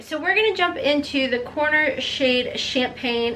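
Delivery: ordinary delivery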